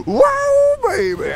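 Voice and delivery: gasping, high, loud whoop; excited whoop. Real, not acted. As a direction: cheer at the spin. Excited by spinning horse.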